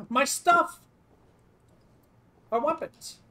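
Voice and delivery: high, loud cry of joy; overjoyed high yelp; excited shout. Real, not acted. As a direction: yell out delighted to have the gear back. Getting gear back, overjoyed.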